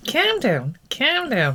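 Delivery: mildly imitating a Scouse referee calming a player; Scouse accent. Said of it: "in his broad Scouse accent".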